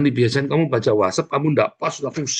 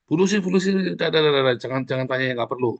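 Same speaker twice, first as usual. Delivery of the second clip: higher, quick brush-off rebuke; 3 semitones higher than usual. Shown as a demonstration. Teacher rebuking a student's needless question, higher brush-off. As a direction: wave them off, dah dah dah. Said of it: "Nah, itu tegur".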